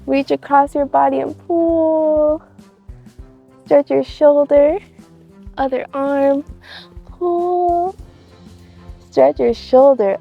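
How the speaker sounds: loud, projected stretch instructions; exercise leader calling out. Real, not acted. Host leads kids through arm stretches, calling out loudly. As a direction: call the moves out loudly, holding key words. Leading children's stretches outdoors.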